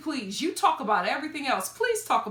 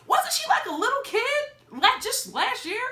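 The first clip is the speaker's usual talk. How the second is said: surprised; 13 semitones higher, 4 dB louder than usual. Real, not acted. Surprised at teen growing up.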